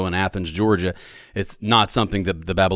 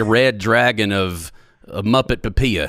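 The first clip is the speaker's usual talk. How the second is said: slower Southern US accent; slower than usual. Shown as a demonstration. Guest parodying the phrase as said in the South, Southern accent. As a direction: say it slowly, Southern style. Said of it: "In the South, we just say".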